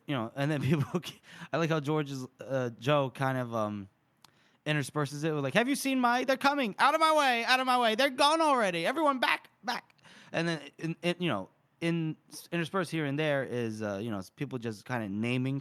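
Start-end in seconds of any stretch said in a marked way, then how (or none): 5.5-10.0 high, urgent overlapping cries of troops; panicked shouting. Acted. Reader voices fleeing soldiers in the chaos, panicked shouting. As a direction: call out high and frantic, pushing through a crowd.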